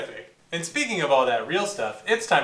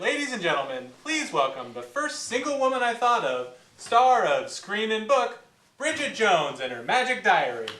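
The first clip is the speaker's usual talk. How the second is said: big, higher, slowed announcer build; louder game-show style introduction; 6 semitones higher, 3 dB louder than usual. Acted. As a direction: introduce the star to the crowd. Host introduces the guest.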